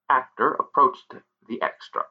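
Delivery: ordinary delivery, nothing marked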